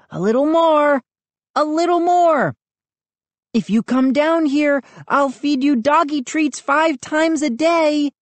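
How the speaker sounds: high, urgent coaxing; pleading. A narrator's reading. Brother bribing dog to come down, pleading. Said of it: "I pleaded".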